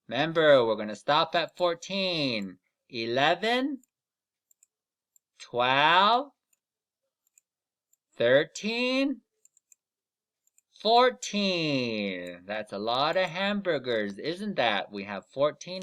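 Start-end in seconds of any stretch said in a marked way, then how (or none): 2.9-12.4 put-on slow count-along for kids; counting aloud. Shown as a demonstration. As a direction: count each number out for the class. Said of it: "Make sure you're counting with me".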